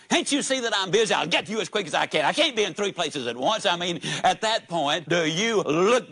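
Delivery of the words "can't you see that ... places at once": fast, mildly snappy comeback; harried waitress. Acted. Waitress to a waiting customer, harried and fast. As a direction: rush it out like an overworked server.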